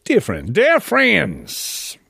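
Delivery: high, drawn-out put-on accent; mock letter-reading in a high caricature accent; Troy Newman, generic accent. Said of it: "he doesn't exactly talk like that".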